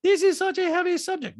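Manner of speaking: loud, high mimicking voice; Diana. Diana scolding Sutton mid-quarrel, high mock impression. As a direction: imitate her protesting up high. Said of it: "Diana's like".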